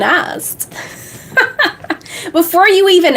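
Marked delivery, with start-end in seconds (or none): 1.4-3.2 laughing. Real, not acted. Laughs at the question.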